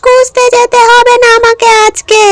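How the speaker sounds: loom over the intruder like a hungry ghost; petni ghost voice. Acted. Ghost threatening the girl who wandered into her trees, high eerie voice.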